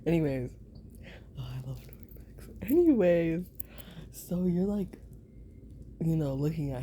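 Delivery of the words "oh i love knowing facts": fast, much softer whisper; quick hushed self-aside. Real, not acted. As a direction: mutter it to yourself fast.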